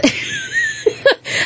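a high, breathy burst of laughter; real embarrassed laugh. Real, not acted. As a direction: crack up mid-story. Laughing at school memory.

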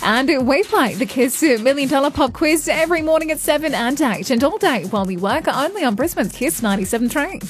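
ordinary talk

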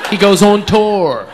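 slow emphatic lesson on tour; stress on tour. Shown as a demonstration. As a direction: teach the word tour. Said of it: "There's your little lesson".

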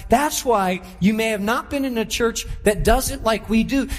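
ordinary talk

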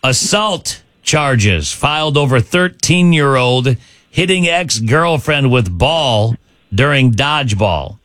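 slow, deliberate announcer read with pauses; measured quiz-host delivery, pausing between chunks; slow headline read. Real, not acted. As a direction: read the headline out slowly, phrase by phrase. Host first reads out quiz headline.

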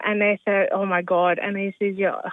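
anesthesia stumbled over, then retried; struggled word. Real, not acted. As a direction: trip over anesthesia and give up in exasperation. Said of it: "I can't say".

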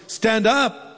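ordinary delivery

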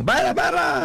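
put-on high rising yell in an Ibagué accent; Ibagué accent shout. Background man shouting at the car, Ibagué accent yell. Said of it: "con ese acento, eh, ibaguereño".

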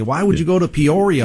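put-on half-hearted Chicago accent, scoffing at the choice; mock Chicago accent. Chicago cop scoffing at the move to Peoria, light mock Chicago accent. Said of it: "I can't do the Chicago accent, but a thick, thick Chicago accent".

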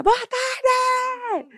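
put-on very high-pitched child's cheery call; grito animado imitado. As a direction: sing out a greeting like an excited little kid. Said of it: "gritar um boa tarde bem animado". (gritinho agudo e animado de criança)

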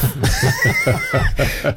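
high, real burst of laughter; helpless high-pitched laugh; laughing fit. Real, not acted. Host cracks up at a blunt childhood memory, laughing.

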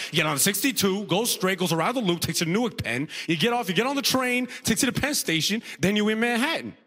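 high-pitched, rattled-off repeated directions; confident first guy. Acted. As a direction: recite the route insistently as the sidekick. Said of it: "He just repeated the instructions".

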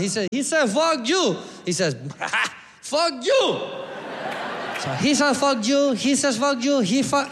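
put-on excited storytelling in a Costa Rican accent; Costa Rican local. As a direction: relive the street fight as an accented Costa Rican. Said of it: "And the local guy".

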